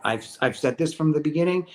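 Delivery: normal talking voice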